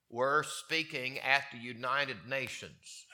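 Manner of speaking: usual speaking voice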